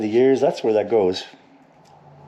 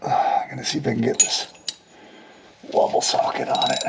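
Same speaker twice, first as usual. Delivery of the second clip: fast whispered aside to self; 3 dB quieter, faster than usual. Real, not acted. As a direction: whisper it quickly under your breath. Muttering to self while working.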